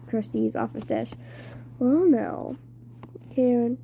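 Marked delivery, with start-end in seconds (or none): 1.8-2.6 put-on strange British-ish accent. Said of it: "He had a strange accent, British, maybe?".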